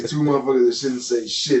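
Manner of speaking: ordinary talk, nothing marked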